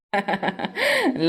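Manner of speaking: quiet pleased laugh; a short, soft satisfied chuckle. Acted. Groom laughs after wedding.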